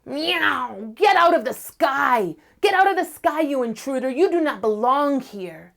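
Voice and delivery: high, loud screech at the intruder; screeching cat. Acted. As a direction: screech him out of the sky. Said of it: "he would screech up to the sky".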